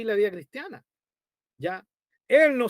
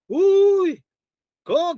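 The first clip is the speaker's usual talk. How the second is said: surprised; 8 semitones higher, 8 dB louder, much slower than usual. Retold or imitated. Recalling being fooled.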